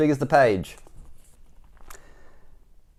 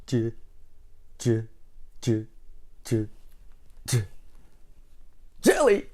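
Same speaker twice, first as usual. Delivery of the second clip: stutter, then pop the name out; imitated stammer; 5 dB quieter than usual. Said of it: "Six J's before he says jelly".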